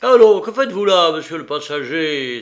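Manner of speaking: loud, high, fast challenge; brisk captain's scolding; shouted reproach. A narrator's reading. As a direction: call the idle passenger out. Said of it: "s'écria le capitaine".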